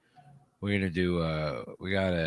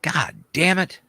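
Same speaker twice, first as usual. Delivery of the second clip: loud exasperated curse; frustrated outburst; 10 semitones higher, 6 dB louder than usual. Real, not acted. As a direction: blurt it out, annoyed. Typo noticed on stream ticker.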